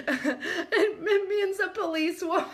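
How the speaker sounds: high, giggle-choked reveal; real helpless laughter; can't stop laughing. Real, not acted. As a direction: blurt the answer through tears of laughter. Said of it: "I can't even say it without".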